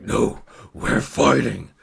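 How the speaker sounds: slow, drawn-out beast voice; werewolf challenge. Retold or imitated. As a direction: insist on the fight like a looming werewolf. Werewolf man repeats it more forcefully.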